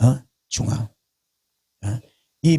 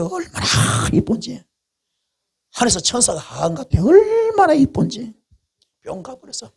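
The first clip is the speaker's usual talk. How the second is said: smitten gush; 7 semitones higher than usual. Retold or imitated. David smitten by the woman.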